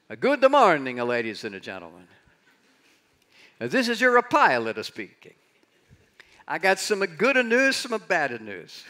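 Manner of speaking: loud, high-pitched Italian airline pilot; Italian accent. Acted. Pilot telling passengers good and bad news, hammed-up Italian accent. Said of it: "this is my Italian accent, not so good".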